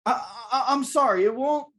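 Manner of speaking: high, stammering apology; apologetic tenant. Acted. Danny apologizing to the angry landlord, high and stammering. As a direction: stumble over an apology as a meek tenant. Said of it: "I'm gonna be Danny".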